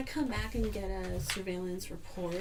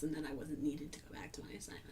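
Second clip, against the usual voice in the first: whispering; 4 semitones lower, 9 dB quieter, much faster than usual. Real, not acted. Hushed aside during report.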